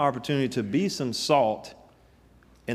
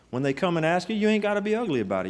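preaching peak; 8 semitones higher, faster than usual. Acted. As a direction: push it up to the top. Preaching climax.

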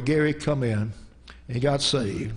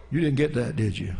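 usual speaking voice

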